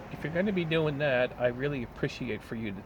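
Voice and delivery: high, prissy mock scolding, ending on a shrill "Thank you"; mock the rude barista's fake-polite telling off; snotty coworker. Rude barista scolding the young coworker, high mocking impression. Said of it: "She was being a fucking bitch".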